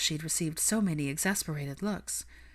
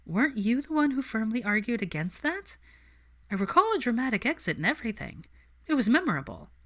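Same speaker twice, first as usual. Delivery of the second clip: higher, quick teasing challenge; Hermione, teasing; 6 semitones higher than usual. Acted. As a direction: needle him, lifted and quick.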